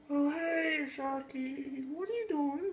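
high, soft puppet-ghost greeting; ghost character. Acted. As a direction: greet Sharky in a small high voice.

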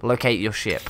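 ordinary delivery

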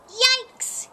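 high, loud storybook yelp; startled cry. A narrator's reading. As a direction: yelp it in sudden alarm. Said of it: "he cried".

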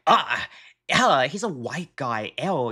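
imitating the streamer's disgusted tone; mock disgusted. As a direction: sneer it with revulsion. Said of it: "the way that she's like".

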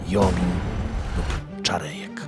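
normal talking voice

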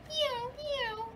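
high little meows; cat meow. Acted. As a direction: mew like a small cat. Tiger tomcat in story.

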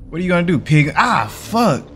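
high, loud taunt breaking into a pained yell; jeer, then cry out as if kicked; shouted. A narrator's reading. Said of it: "your dad shouted".

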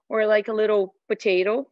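normal talking voice

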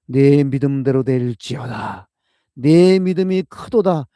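proclaiming loudly. Acted. Closing proclamation of Jesus's words.

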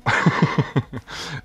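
high, loud chuckle; real laugh at one's own joke; amused laugh. Real, not acted. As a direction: burst into a rapid giggle. Laughs at own first name.

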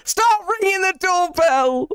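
high, slow drawn-out yell; panicked shout. Real, not acted. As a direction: wail it at the door. Yelling at doorbell in game.

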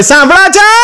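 high-pitched call to husband, rising at the end; drawn-out, high mock call; wife calling out. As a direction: mimic a wife calling her husband from another room. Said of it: "ભાભીએ બૂમ પાડી".

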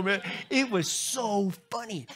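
ordinary talk

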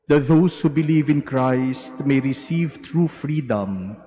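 loud, solemn intoned prayer; liturgical prayer. Acted. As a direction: proclaim it to the congregation. Priest intones collect.

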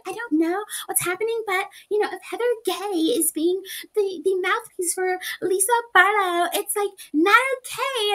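high, sugary fake-concerned voice; imitating Whitney. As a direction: put on a high, sweet worried voice. Whitney voiced talking to Bronwyn.

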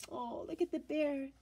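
usual speaking voice

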